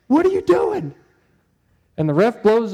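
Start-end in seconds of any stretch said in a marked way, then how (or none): 0.1-0.9 high-pitched, exasperated outcry at the players; exasperated coach. Retold or imitated. Coach to girls running with the ball, exasperated outcry. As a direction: call out in disbelief, pitch jumping right up.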